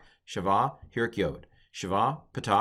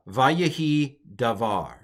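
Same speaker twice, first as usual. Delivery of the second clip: slow, deliberate Hebrew reading; model reading; 3 semitones higher, 4 dB louder, slower than usual. Shown as a demonstration. Teacher reads the phrase to students, slow model reading. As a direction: sound it out slowly for learners.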